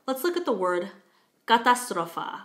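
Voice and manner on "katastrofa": "katastrofa" in Czech, with full "a" vowels; Czech pronunciation. Shown as a demonstration. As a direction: say "katastrofa" the Czech way, first-syllable stress. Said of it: "katastrofa in Czech".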